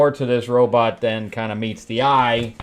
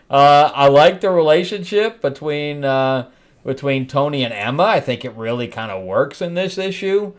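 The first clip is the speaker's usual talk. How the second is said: ordinary talk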